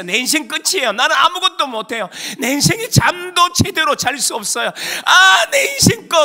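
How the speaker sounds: high, loud tearful lament; acted despair. David lamenting that his life is over, anguished crying out. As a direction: build to a crying shout of despair.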